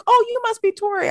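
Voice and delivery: high-pitched daughter impression; high, mock-friendly daughter; imitated friendly daughter. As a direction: greet someone in a high put-on voice. Voices the daughter meeting her.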